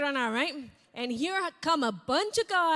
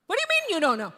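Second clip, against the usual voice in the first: higher and louder, demanding; exasperated husband; 6 semitones higher, 9 dB louder, much faster than usual. Retold or imitated. Husband grilling his wife about her sudden job change, exasperated. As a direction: confront her, fed up.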